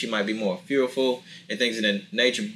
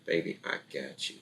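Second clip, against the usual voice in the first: soothing fiance; 5 dB quieter than usual. Retold or imitated. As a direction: soften down to a hushed comfort. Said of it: "if your fiance is like".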